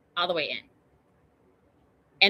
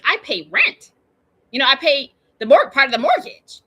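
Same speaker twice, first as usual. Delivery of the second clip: louder, higher mock outrage; indignant protest; 5 semitones higher, 8 dB louder than usual. Rent payer reacting to a tent city, indignant protest. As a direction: protest it emphatically, pitch jumping up.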